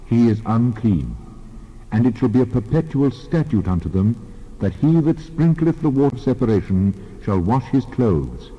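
ordinary delivery

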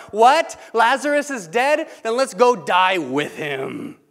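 high, loud, mock-brave disbelief; sarcastic disciple bravado; mock bold disciple. Preacher mocking Thomas's bold faith, high and loud. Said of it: "Hear how full of faith the disciples are".

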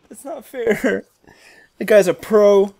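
normal talking voice

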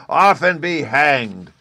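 loud, high, slow angry cry; cried out. A narrator's reading. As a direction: cry it out as you grab him. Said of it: "cried Hans".

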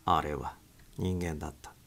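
plain reading voice

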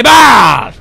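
loud high shocked yelp; startled yelp. Real, not acted. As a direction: yelp at the scare. Jump scare.

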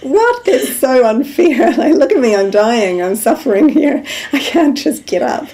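high, loud, laughing mock complaint; mock whiny protest. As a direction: whine like a sulking child. Mock self-pitying protest from sickbed.

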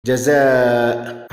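very long final vowel; slow, stretched reading of a word; drawn-out example. Shown as a demonstration. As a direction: hold the long vowel in جزاء.

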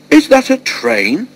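slow, very high-pitched incredulous demand. A narrator's reading. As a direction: demand an answer, voice shooting up. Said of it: "the man demanded".

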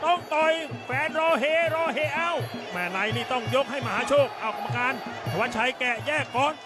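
high, urgent ringside calling; excited boxing commentary. Acted. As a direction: shout the action as it happens. Live Muay Thai fight calling.

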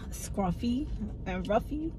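normal talking voice

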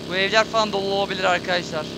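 ordinary talk

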